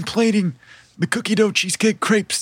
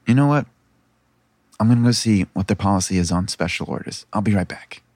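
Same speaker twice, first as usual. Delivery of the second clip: low-voiced companion stepping away; much lower character voice; Caramel; 11 semitones lower than usual. Caramel telling the player she'll check on special orders, low-voiced. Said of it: "she turns to you and says".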